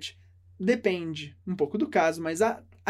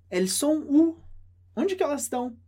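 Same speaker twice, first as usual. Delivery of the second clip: elles sont où rising, pitched higher, as if searching for friends; puzzled question; 5 semitones higher than usual. Acted. Woman at the mall asking where her friends went, puzzled. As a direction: ask it like your friends just vanished. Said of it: "E você pergunta".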